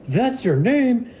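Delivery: very high, loud, slow delighted squeal; gushing excitement. Acted. Demonkin woman meets Pip the dog, high delighted squeal. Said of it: "and she's like".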